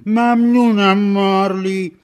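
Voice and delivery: high, loud, slow thanks; Scrooge's grateful cry. Acted. Scrooge thanks Marley loudly in relief.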